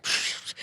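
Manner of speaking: soft, voiceless "pfft"; dismissive puff. Retold or imitated. Audience answering what happened, soft dismissive puff. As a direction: blow air through the lips, imitating a crowd. Imitates the audience's wordless response.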